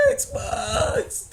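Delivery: high-pitched, drawn-out comic wail; hammed-up crying squeal; mock bawling. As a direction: wail it like you're bawling your eyes out. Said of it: "I was crying, dude".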